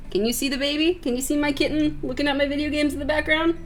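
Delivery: high, soft cutesy voice; high and hushed, doting; cooing over a kitten. Real, not acted. Cooing about the kitten to viewers.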